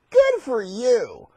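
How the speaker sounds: drawn-out congratulation at normal pitch; slow praise. Acted. Lions congratulating Daniel, slow and drawn out. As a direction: stretch each word of the praise.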